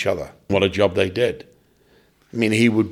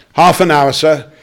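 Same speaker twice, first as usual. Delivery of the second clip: backstage call; 4 semitones higher, 8 dB louder than usual. Retold or imitated. Stage call at dressing room door.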